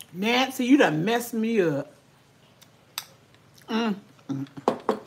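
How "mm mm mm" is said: ordinary talk, nothing marked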